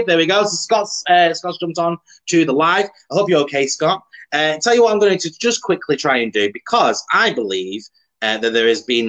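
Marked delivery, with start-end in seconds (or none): none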